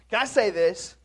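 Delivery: ordinary talk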